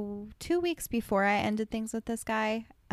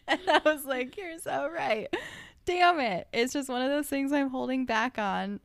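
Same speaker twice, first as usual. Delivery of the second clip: laughing; 7 semitones higher than usual. Real, not acted. Laughing at therapist's point.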